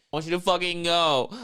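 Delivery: loud, high retold outburst; mock angry demand. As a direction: imitate a girlfriend telling him off. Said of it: "emotional intensity of the woman".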